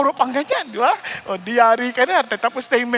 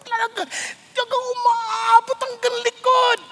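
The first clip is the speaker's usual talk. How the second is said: mock exaggeration; 10 semitones higher than usual. Mock exaggerated complaint of headache.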